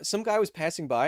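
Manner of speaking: ordinary talk, nothing marked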